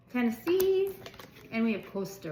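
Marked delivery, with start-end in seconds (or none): 0.1-0.9 Tennessee sung out high and bright with delight; delighted exclaim. Real, not acted. Reading her new cards aloud, she exclaims the name with delight. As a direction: cry the name out, thrilled to find it.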